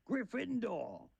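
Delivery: high, long drawn-out announcement; slow theatrical proclamation; hat's shout. A narrator's reading. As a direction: stretch it out in a high cry. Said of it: "When it finally shouted".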